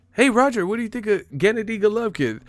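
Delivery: high-pitched eager interviewer impression; Ellie Seckbach. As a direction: imitate a reporter calling out a question. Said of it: "I remember his voice".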